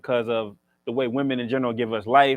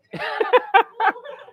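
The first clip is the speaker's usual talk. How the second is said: high giggle; 4 dB louder than usual. Real, not acted. Laughs at own joke.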